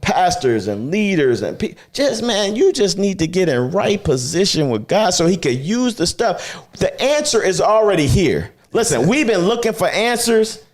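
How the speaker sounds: high, ringing preacher's voice; preaching at full force. Acted. Pastor preaches to listeners, high fervent preaching voice. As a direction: preach it out to the congregation.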